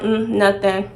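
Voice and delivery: low, quiet sulky reply; short and withholding; cold brush-off. Retold or imitated. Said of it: "wasn't really being super enthusiastic".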